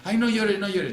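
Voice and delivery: high, loud, exaggerated "Ay, no llores" as if hushing a crying friend; mock fussy consoler. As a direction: ham up the anxious comforter, pitched high and loud. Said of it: "la gente dice".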